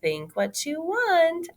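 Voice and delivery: calm retort. Acted. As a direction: answer him coolly. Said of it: "Noelle replied".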